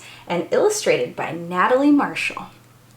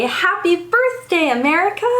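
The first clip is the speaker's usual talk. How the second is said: festive cheer; 7 semitones higher than usual. Real, not acted. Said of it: "say it with me".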